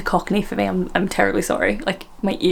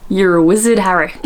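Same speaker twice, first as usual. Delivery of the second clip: mock West Country giant, louder; Hagrid; 5 dB louder than usual. Host attempting a film character, mock West Country. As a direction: put on a hearty rhotic West Country voice. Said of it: "good example is Hagrid".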